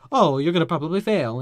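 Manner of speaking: exaggerated posh American put-down; posh American accent. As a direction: imitate a pompous headmaster's posh American accent. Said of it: "in his posh American accent".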